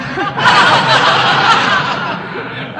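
big studio audience laugh; a crowd laughing loud; audience laughter. Real, not acted. Studio audience laughing at the teacher's joke.

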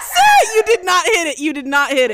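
loud high excited yell; shouting. Real, not acted. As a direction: shout at her in disbelief. Shouting at friend after miss.

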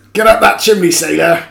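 loud, high barked order. Retold or imitated. Actor ordering a man up the pub chimney, imitated shout. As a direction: bellow it as an imitated drunk-pub command. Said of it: "[name] told him to get up the chimney".

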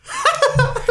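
bursts into a high-pitched giggle; a sudden squealing laugh. Real, not acted. Breaking up at his own joke, high giggle.

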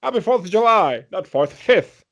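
put-on high, loud, wavering old president voice; mock Biden impression. Podcast host joins the Biden bit, high mock president voice. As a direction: ham up a confused old Biden. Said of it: "Kyle Dunnigan".

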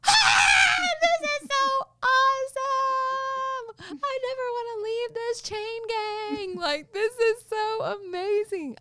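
high, slow, crying exclamation of joy; ecstatic cry. Retold or imitated. Worshipper caught up in the chain-breaking prayer, crying out with joy. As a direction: cry out in overwhelming delight. Overjoyed reaction in the worship circle.

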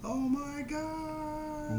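high, very drawn-out exclamation; hammed-up, long high wail; mock-horrified movie quote. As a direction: stretch it out slowly in a high voice. Quoting Troll 2's drawn-out exclamation.

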